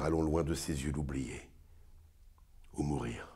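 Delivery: hushed, hesitant tragic lover thinking aloud; Antiochus in monologue. Acted. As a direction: murmur private torment, swelling briefly into protest. Performs Antiochus's monologue from Bérénice.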